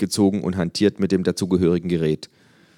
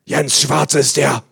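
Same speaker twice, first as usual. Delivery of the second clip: put-on higher, louder, slow old Berlin busybody; nosy neighbour; 9 semitones higher, 8 dB louder, slower than usual. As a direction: squawk like a gossiping neighbour. Neighbour voiced in story.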